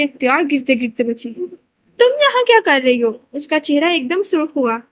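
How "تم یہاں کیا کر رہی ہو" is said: startled. Acted. Caught eavesdropper at door.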